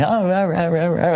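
mock soothing. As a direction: imitate a TV ranter turning soothing, in gibberish. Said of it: "he gets very soothing".

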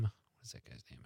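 whispered, very soft aside to self; muttered aside. Real, not acted. Host searching memory for actor's name, muttered aside. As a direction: drop to a near-silent whisper.

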